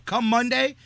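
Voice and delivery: emphatic. Real, not acted. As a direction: hammer the deadline home. Heated argument point.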